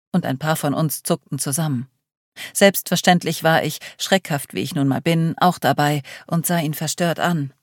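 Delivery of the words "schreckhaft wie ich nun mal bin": usual speaking voice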